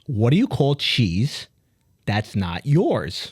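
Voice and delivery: slowed, deliberate retelling of the riddle; slowed-down punchline delivery; slow repeat. Shown as a demonstration. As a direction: spell out the joke slowly for a slow listener. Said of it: "bring it back to reality".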